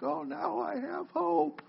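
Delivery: high, soft, slow imitation of tearful relief; mock near-tears. Preacher mimicking vaccine patients on TV, mock tearful. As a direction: imitate someone almost weeping with hope. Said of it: "they're almost in tears and like".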